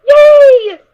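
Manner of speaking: loud, high squeal of joy; a little filly cheering. Acted.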